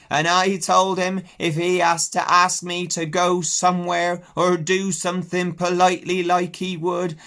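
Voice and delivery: loud, high, slow country ewe; Ma telling the flock how to behave; old ewe speechifying. Acted. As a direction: address the flock firmly. Ma addressing flock.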